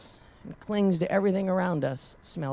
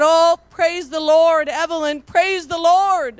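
weeping praise; 9 semitones higher, 7 dB louder than usual. Retold or imitated. Preacher overcome, praising the Lord with the woman. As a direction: cry it out, tears in the voice. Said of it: "And I was weeping".

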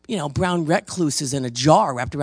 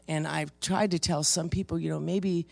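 usual speaking voice

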